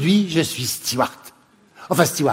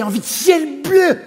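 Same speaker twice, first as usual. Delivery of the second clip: yearning, half-shouted wish; giddy shout for blue sky; excited cry; 9 semitones higher than usual. Acted. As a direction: pitch jumps up as she cries out for blue sky. Dreamy rant about escaping.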